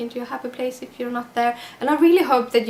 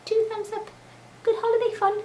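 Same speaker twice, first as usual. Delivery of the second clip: cheery high repeat; 13 semitones higher than usual. Shown as a demonstration. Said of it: "Oh, like that".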